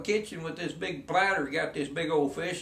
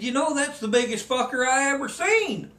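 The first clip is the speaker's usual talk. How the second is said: amazed guide; 9 semitones higher, 3 dB louder than usual. Retold or imitated. Guide marvels at big fish.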